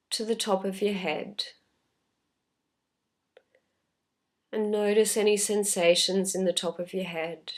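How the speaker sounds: unhurried, calm meditation voice with long pauses; guided meditation. Real, not acted. Teacher leading listeners through a body scan, calm guided meditation. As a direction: gently guide them inward, leaving space after each phrase.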